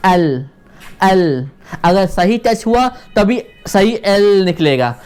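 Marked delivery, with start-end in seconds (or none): 0.1-1.5 "एल" with the tongue touching the palate; demonstrated L sound. Shown as a demonstration. Teacher showing students tongue touch, demonstrated L sound. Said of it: "उसको touch करता है ऐसे".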